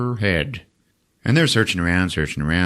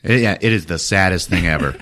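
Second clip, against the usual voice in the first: ordinary delivery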